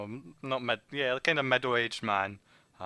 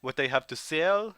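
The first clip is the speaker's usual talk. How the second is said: put-on higher, drawn-out French-accented English; 4 semitones higher than usual. Rotund informant offering what he has to sell, French accent. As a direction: sales pitch in a hammed-up French accent. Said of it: "your French accent".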